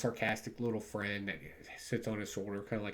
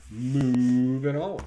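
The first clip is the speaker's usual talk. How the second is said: stretched slowly and louder; drawn-out announcement; 3 semitones higher, 11 dB louder, much slower than usual. Real, not acted. Reviewer announcing the next comic, drawn-out and loud. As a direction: draw it out like a show host.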